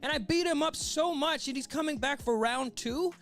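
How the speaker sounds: high-pitched acted panic; hammed-up villain losing his cool; freaking out. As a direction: ramp up into incredulous, high disbelief. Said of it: "So you hear Crocodile freaking out".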